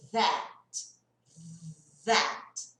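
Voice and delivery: "that" with a buzzing TH, tongue between the teeth; vibrating TH in "that"; voiced TH demo. Shown as a demonstration. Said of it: "o primeiro ali". ("that" com TH vibrando entre os dentes)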